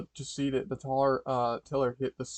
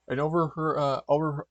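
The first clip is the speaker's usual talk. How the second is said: usual speaking voice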